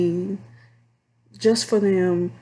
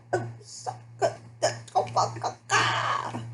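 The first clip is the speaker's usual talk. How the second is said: stunned breathy laugh; 10 semitones higher, 7 dB quieter than usual. Real, not acted. Stunned by rude caller comment.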